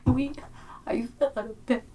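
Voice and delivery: put-on high, whimpering little kid; toddler voice. Little boy recounting his fall to the aunt, whimpering child voice. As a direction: play a hurt toddler telling his woe. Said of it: "he was like".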